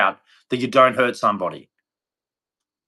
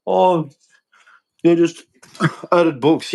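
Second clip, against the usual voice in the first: higher, slow mock objection; mocking critics; 5 semitones higher, slower than usual. Speaker quoting people who dismiss the books, mocking. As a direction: imitate a dismissive critic, drawn out and pitched up.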